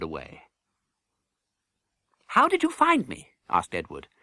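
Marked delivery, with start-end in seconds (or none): 2.3-3.3 put-on high, curious boy's voice; young prince. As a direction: ask Miles eagerly. Edward's voice.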